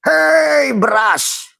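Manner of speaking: very high, slow, drawn-out call; yellow rice calling. Acted. The yellow rice calling out to the plain rice, high and stretched. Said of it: "Nasi kuning bicara".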